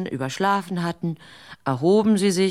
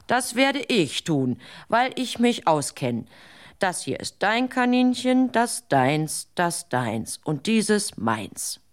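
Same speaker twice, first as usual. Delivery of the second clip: slow counting out; 3 semitones higher, slower than usual. Acted. Dunce dividing rabbits slowly.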